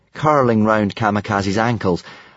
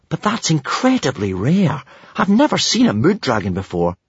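higher-pitched marvelling; astonished; 6 semitones higher than usual. A narrator's reading. Hiccup marvels at the rare dragon, astonished. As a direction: gush with amazement. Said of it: "exclaimed in astonishment".

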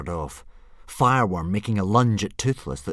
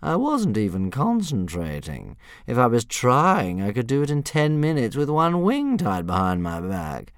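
a hint of a preening bully dragon, louder; vain dragon boast; 6 semitones higher, 5 dB louder than usual. A narrator's reading. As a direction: show off with fake modesty. Fireworm boasting.

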